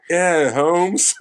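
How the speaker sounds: mock the bad accent lightly; fake Spanish accent. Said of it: "Spanish accent is terrible".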